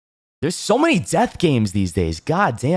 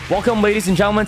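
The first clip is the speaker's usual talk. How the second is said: slick game show host, higher; Cupid King; 3 semitones higher than usual. Acted. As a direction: welcome the crowd like a showman. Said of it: "I'm your host, Cupid King".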